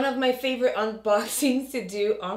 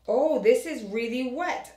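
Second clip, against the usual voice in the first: ordinary delivery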